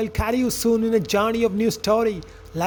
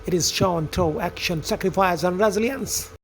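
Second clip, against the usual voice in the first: lower, measured storyteller voice; story narration; 6 semitones lower, 3 dB quieter than usual. A narrator's reading. Narrator telling friends of farmer Jonathan and son Joe, story narration. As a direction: narrate it like a fable, evenly.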